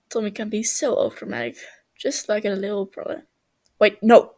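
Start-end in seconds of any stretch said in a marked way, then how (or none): 3.8-4.3 loud, startled self-correction; sudden catch. Acted. Techno catching his own slip about brothers, startled. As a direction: catch yourself mid-thought.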